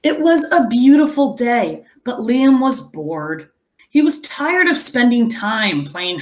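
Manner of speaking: put-on lively read-aloud to children; story narration. A narrator's reading. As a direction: tell the story to the class, expressive. Reads the picture book aloud.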